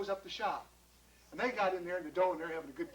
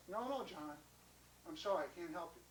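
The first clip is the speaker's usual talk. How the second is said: gentle refusal; 2 semitones lower, 8 dB quieter than usual. Acted. Mister Bischoff turning John away, gentle refusal. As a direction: let them down quietly.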